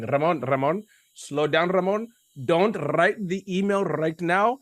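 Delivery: a gentle hint of an Indian accent, slow. Indian mentor soothing an upset young Ramon, light Indian accent. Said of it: "I mimic him in honor".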